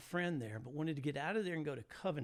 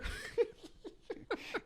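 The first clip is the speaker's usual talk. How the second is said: airy high-pitched laugh; high breathless laugh. Real, not acted. Storyteller laughing at his own hitchhiking tale, breathy and high. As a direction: laugh it out, almost silent.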